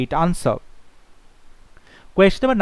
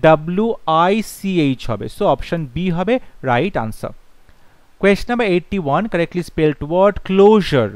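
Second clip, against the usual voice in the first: ordinary talk, nothing marked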